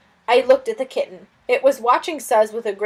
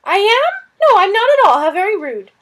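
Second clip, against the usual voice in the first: high, loud, huffy lizard voice; a fussy lizard taking offence; offended lizard; 10 semitones higher, 8 dB louder than usual. Acted. Lizard Suz affronted by the girl, high and loud.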